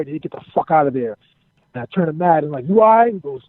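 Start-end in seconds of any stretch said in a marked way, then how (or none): 2.7-3.1 raised, higher voice, acting concern; retold alarmed check. To a friend who jumped from VIP, retold alarmed call.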